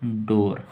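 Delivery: डोर said slowly with a clear retroflex ड; clear model pronunciation. Shown as a demonstration. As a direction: pronounce डोर carefully for learners. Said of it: "इसका उच्चारण होगा". (डोर का साफ़ उच्चारण)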